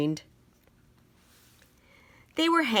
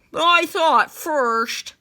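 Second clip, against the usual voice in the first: slow, indignant squeak of surprise; can't believe his friend disputes it; surprised protest; 9 semitones higher, 7 dB louder, slower than usual. Acted. Willie, surprised, protests to Wally that he was first. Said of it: "Willie was surprised".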